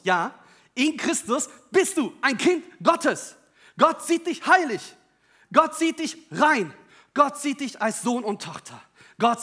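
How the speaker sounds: loud, slow, rising proclamation; slow ringing pulpit emphasis; preaching proclamation. Real, not acted. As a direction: proclaim it to the congregation.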